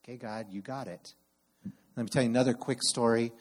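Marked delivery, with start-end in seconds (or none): 0.0-1.1 much softer, settled trust; quiet surrender. Shown as a demonstration. Pastor modeling trusting prayer to God, very soft. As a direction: breathe out and hand it to God. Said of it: "settle into".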